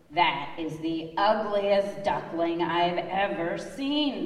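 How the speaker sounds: slow, mock-shocked neighbor duck; a lightly voiced nosy neighbor, slow and scandalised; neighbor screeching. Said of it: "the neighbor screeched".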